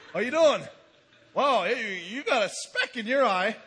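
loud, jokey voice of a man with a plank in his eye; mock friendly greeting. Man with a board in his eye cheerily greeting a neighbour, mock loud. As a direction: ham up a cheerful hello with a laugh.

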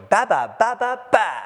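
mock sheep bleating ba ba; preacher playfully bleating like a sheep. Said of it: "a sheep looking at a false shepherd going".

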